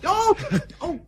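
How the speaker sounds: sudden high, loud startled oh; startled cry. Acted. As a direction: jolt as the porter spills. Porter spilled on the bed.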